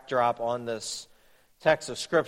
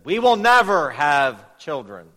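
louder, declared firmly; emphatic vow; 2 semitones higher, 8 dB louder than usual. Retold or imitated. Husband telling his wife over a screaming child, louder. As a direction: announce it over the noise.

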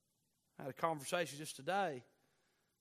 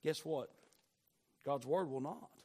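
usual speaking voice

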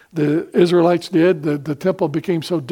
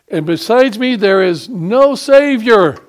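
loud, slow, higher proclamation; thundering preacher; 5 semitones higher, 6 dB louder, slower than usual. Real, not acted. Preacher booms God's words to the congregation, loud and slow. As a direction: drive home that there is no Savior.

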